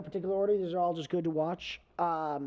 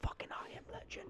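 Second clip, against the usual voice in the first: hushed awed whisper; 16 dB quieter than usual. Retold or imitated. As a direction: breathe it like a gasp in the dark. Retelling audience reaction in theater.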